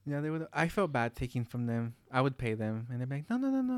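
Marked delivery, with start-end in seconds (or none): 3.3-3.7 high-pitched, imitated protest; rapid-repeat mock refusal, pitched up; retold refusal. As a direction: imitate grandparents waving off money, voice high. Retold grandparents refusing payment.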